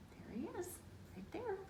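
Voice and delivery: plain reading voice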